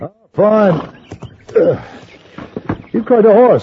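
excited. Acted. Rider arriving excited at camp.

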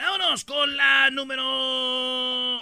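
showman's long-held call; drawn-out announcer call. Real, not acted. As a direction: belt it out and hold the last word. Host calling out the next countdown item.

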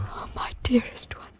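soft, loving whisper; tender whisper. Acted. To her lover, tender whispered comfort. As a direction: cradle each word. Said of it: "with a tender voice".

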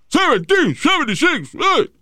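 high, slow mock chant of the year; mock yell. The man on the train jeering at the lady with a high chant. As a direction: belt it out like a jeering heckler. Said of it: "empieza a gritarle vaina".

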